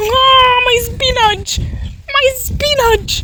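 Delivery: high, slow wail over ruined spinach; dismayed wail. Real, not acted. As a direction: lament it, dragged out. Dogs trampling her spinach.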